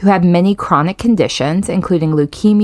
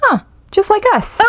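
high squeaky voice. Acted. Playful joke about bubbly.

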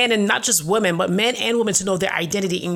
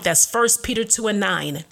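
usual speaking voice

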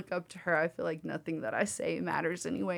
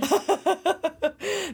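laughing; 11 semitones higher, 6 dB louder than usual. Real, not acted. Echoing space lasers laughing.

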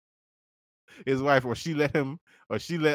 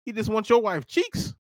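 usual speaking voice

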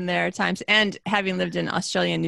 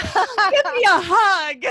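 much higher, louder and drawn-out slow, thrilled greeting; excited; 12 semitones higher, 5 dB louder, much slower than usual. Retold or imitated. Greeting the man from Ohio at church, slow and thrilled. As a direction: stretch it out, overjoyed to find a fellow Midwesterner. Meeting fellow Midwesterner abroad.

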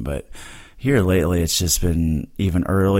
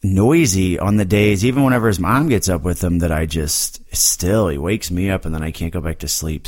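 ordinary talk

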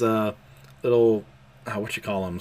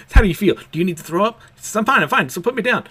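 frantic urgency; 8 semitones higher, 7 dB louder, faster than usual. Retold or imitated. Frantic Yor, protesting Becky.

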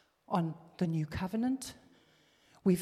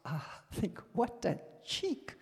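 usual speaking voice

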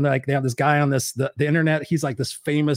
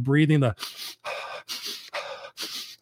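demo breath; 4 dB quieter than usual. Shown as a demonstration. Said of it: "I'm doing the".

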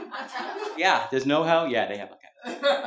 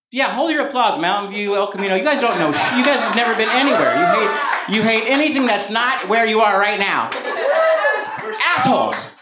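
loud, raised-pitch playful rant; mock scolding; 6 semitones higher, 5 dB louder than usual. Comedian telling off the audience, mock-angry rant. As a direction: berate the crowd, building to a loud insult.

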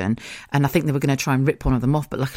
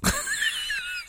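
sudden squealing burst of laughter; real high-pitched cackle; high shrieking laugh; 7 dB quieter than usual. Real, not acted. Laughing at own story.

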